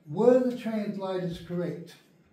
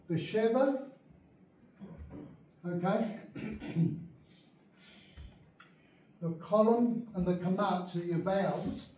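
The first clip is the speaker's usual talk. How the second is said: normal talking voice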